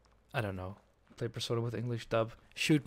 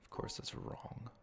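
whispering; 12 dB quieter, slower than usual. Real, not acted. Muttering at puzzle.

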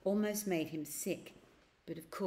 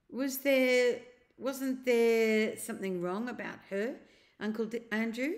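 put-on a boy's question, higher and louder, faltering; hesitant boy; 5 semitones higher, 5 dB louder than usual. A narrator's reading. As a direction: ask warily, stumbling on the name. Diggory asking hesitantly.

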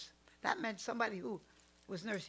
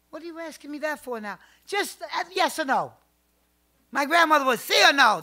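loud, higher, pushy questioning; insistent demand; 6 semitones higher, 15 dB louder than usual. Retold or imitated. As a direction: press him for a straight answer. Grandmother presses the doctor.

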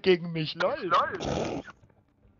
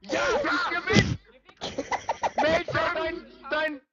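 high yells turning to a victory whoop; frantic high yelling; 8 semitones higher than usual. Real, not acted. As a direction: scream at the game, then crow the win. Heated fight, then victory cry.

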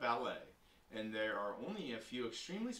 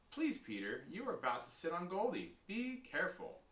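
mild scolding, rising higher; 6 semitones higher than usual. A narrator's reading. Sister warns Peter about sitting on Goldie, scolding. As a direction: scold gently with growing urgency. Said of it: "I scolded".

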